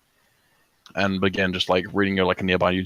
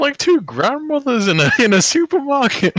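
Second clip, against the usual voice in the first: laughing delight; 5 dB louder than usual. Real, not acted. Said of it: "laughing comment".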